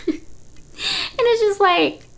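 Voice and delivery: laughing. Real, not acted. Laughing at the scene.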